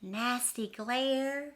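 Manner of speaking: ordinary delivery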